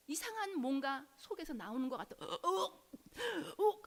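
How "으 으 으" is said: slow, drawn-out guttural groans; deep groaning. Retold or imitated. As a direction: let each groan well up slowly from the gut. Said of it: "하는 이런 소리를 내면서". (속에서 끌려 나오는 느린 신음 소리)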